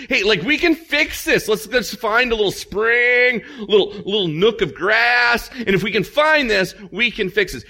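high, loud, laughing can-do voice; breezy King Ahab. Acted. Ahab cheerfully shrugs off the drought, high and laughing. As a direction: chirp it like nothing's wrong, half laughing. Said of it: "He's worried about his mules and his horses".

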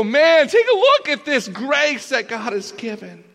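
high, loud burst of amazement; amazed angels. Acted. Angels peering into our backpack at grace, amazed. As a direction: exclaim it way up high, thrilled.

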